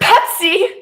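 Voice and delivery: high, loud scandalised Pepsi; mock disgust. On hearing Pepsi, high mock outrage. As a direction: gasp at the very idea of it. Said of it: "Ew, Pepsi".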